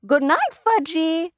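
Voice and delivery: put-on high, slow little-kid goodnight; sleepy toddler. A narrator's reading. Toddler waves goodnight to the family, slow and high. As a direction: say it like a small child.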